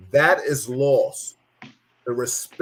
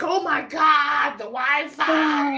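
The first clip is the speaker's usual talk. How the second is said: high, slow, hammed-up wail of a kid; whiny kid; 12 semitones higher, much slower than usual. Host mimics kids whose Wi-Fi is taken, high slow mock wail. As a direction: drag it out like a spoiled kid losing the Wi-Fi. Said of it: "kids be like".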